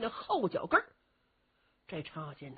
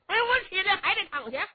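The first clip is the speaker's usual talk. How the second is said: whiny complaint; 11 semitones higher, 4 dB louder than usual. Acted. Cheng Yaojin whining.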